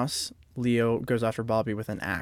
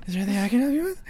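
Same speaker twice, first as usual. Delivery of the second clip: squeaky high-pitched mock politeness; exaggerated high-voiced parody of the villain; high mock Leo voice; 9 semitones higher, much faster than usual. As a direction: pitch it way up, sing-song. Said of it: "the inflection of his voice".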